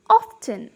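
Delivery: "often" with the T sounded; American pronunciation. Shown as a demonstration. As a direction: repeat American "often" with a clear T. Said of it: "in American accent".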